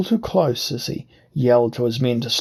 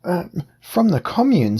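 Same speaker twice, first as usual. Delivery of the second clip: higher, pleading disguised voice; wheedling whine; 7 semitones higher, 3 dB louder than usual. Acted. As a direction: whine it up to sound harmless. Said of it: "I changed my voice to a wheedling whine".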